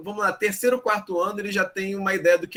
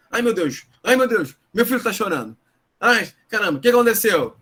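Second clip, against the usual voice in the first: panicky parent; 4 semitones higher, 5 dB louder than usual. Pretend.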